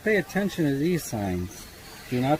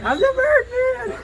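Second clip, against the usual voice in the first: high squeaky voice; 4 dB louder than usual. Pretend. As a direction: squeak at the bird like a toy. High silly voice to bird.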